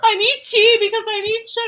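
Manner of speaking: put-on high, fast, laughing Australian accent; laughing Aussie quote. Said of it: "She kept saying".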